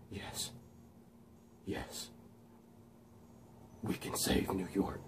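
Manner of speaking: very soft, breath-only acted whisper; whispered character voice. Max agreeing with the voice, hushed whisper. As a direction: whisper it slowly, with long gaps. Acts out Max being persuaded.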